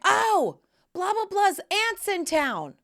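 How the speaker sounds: high-pitched mock kid reply; imitated excited son. Son telling mom about the visitor, imitated high voice. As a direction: toss it back high, glossing over the details.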